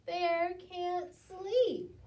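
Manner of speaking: loud, slow storybook punchline; dramatic slow reveal. A narrator's reading. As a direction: land the final twist big.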